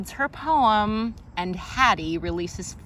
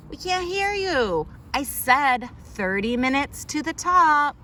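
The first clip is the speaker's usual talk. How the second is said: calling out; 6 semitones higher than usual. Acted. Characters calling out on hike.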